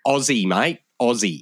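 Aussie, mate said "ozzy, mite"; Aussie, mate in a broad Australian way. Shown as a demonstration. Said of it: "should have Z's, not S's".

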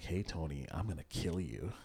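lightly voiced menacing caller, low and soft; low-pitched, hushed mock threat; prank caller's threat. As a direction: quietly deliver a mock phone threat. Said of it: "He's like".

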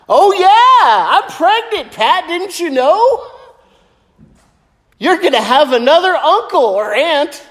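high, chirpy lightly voiced grandma; playful old lady, teasing; lightly voiced grandma breaking news. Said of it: "she goes".

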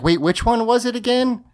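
confused. Retold or imitated. Self-quote of confused question.

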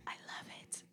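very soft, voiceless whisper; delighted whisper. Real, not acted. Host tickled by co-host's impression, delighted whisper. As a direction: whisper it, savouring the joke.

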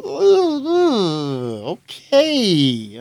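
high, drawn-out hammed-up hesitation; mock startled realisation. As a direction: stretch it out as if caught off guard. Acting his reaction to future self.

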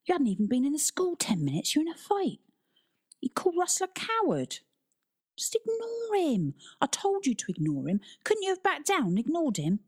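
exasperated high-pitched scolding; grilling. A narrator's reading. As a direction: interrogate him, pitch leaping up on each complaint. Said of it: "Toby grilled Tristan".

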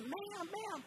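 high-pitched imitation of a baffled waiter; bewildered waiter. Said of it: "So the waiter comes over and said".

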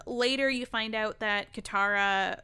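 normal talking voice

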